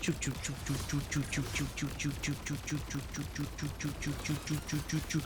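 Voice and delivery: soft mouth-made choo choo gunfire; quiet gunshot sound effects. Pretend. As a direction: murmur the gun noises under your breath. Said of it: "Is that you or the game going choo choo choo?".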